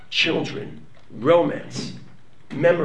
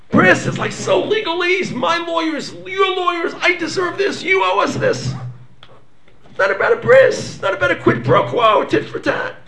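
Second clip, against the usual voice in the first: dismissive passion; 13 semitones higher than usual. Acted. Mocking legalistic covenant talk.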